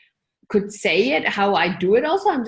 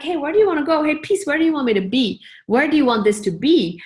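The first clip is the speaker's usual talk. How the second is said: louder, higher call to the piece; 5 semitones higher, 5 dB louder than usual. Retold or imitated. Asking her dance piece where it wants to go, calling out louder. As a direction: call out as if across the room.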